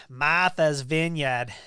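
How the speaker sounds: slow, loud try at an East Coast accent, dropped r; a hammed-up New England accent on Martha's Vineyard; Eastern accent. Shown as a demonstration. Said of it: "I don't have a very good Eastern, Eastern accent there".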